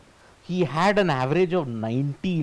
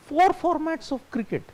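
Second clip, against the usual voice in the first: high-pitched disbelief at a claim; incredulous repeat; 12 semitones higher than usual. Real, not acted. Repeats viewer's puzzling claim in disbelief.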